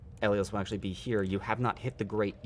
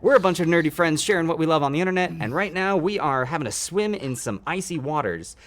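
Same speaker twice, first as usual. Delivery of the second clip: loud, high, fast welcome spiel; fast announcer-like greeting with a laugh in it; streamer shout-out; 7 semitones higher, 8 dB louder than usual. Real, not acted. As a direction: hype up a new follower, loud and upbeat. Streamer thanks and welcomes a new follower.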